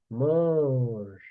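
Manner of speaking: "Mange" drawn out as one syllable; French pronunciation demo. Shown as a demonstration. Teacher showing the rest all sound alike, slow demo. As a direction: hold the vowel in "Mange", silent final e. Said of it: "O resto é tudo mange".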